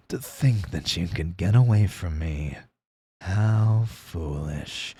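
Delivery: low, slow, sneering villain; smug anime villain. Retold or imitated. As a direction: drawl it out, looking down on them. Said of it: "people want me to be the more".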